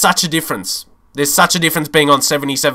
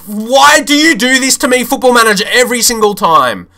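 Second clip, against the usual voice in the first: much higher, louder, pleading rant at the game; louder, higher complaint at Football Manager; exasperated outburst; 9 semitones higher, 4 dB louder than usual. Real, not acted. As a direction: shout it to the heavens, fed up. Yelling at the game after conceding.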